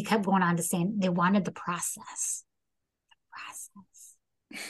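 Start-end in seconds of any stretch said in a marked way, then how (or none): 3.1-4.6 hushed, drawn-out whisper; very soft, slow whispered repeat; whispered echo. Real, not acted. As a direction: whisper it slowly as an aside.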